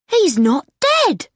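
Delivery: high-pitched, joyful exclamation; happy. A narrator's reading. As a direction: announce it with happy relief. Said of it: "said D J happily".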